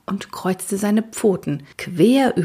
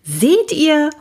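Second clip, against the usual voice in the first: excited cry; 9 semitones higher, 5 dB louder than usual. Acted. Kids find the unicorn.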